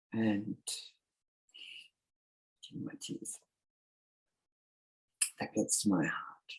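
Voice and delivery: choked up and fading; holding back real tears; teary. Real, not acted. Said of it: "sorry, I get teary".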